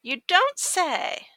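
sarcastic. Acted. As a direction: drag it out with heavy sarcasm. Sarcastic reply.

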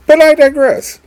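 very high, loud comic aside; high squeaky aside. Pretend. Comic aside against later plain take.

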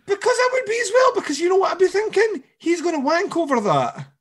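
very high, loud squawk; mock outrage. Co-host reacting to killer wanting hair, high mock-outraged squeal. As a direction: squeal it loudly in comic disgust.

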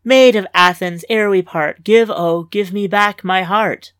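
dramatic poetry recital; passionate recitation. Acted. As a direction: declaim the poem to win her over. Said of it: "I launch into a passionate recitation".

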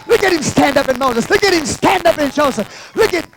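loud, high-pitched revival shouting; full-force preaching. Real, not acted. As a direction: build into driving, repeated cries.

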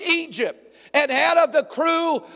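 usual speaking voice

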